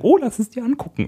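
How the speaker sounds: put-on high eager girlfriend voice; chirpy imitation of an excited girlfriend. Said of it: "wird sie sagen".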